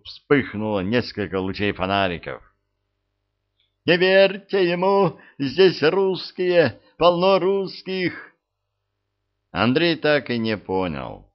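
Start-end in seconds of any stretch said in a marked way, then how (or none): 3.9-8.3 high slow desperate warning cry; wounded man screaming a warning; man down shout. A narrator's reading. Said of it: "заорал раненый".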